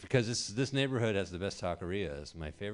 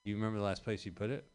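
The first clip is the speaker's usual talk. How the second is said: quick mock helpful reply; 2 semitones higher, faster than usual. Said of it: "And I'm like".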